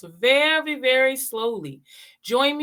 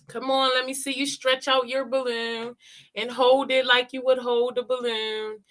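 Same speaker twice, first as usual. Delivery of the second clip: usual speaking voice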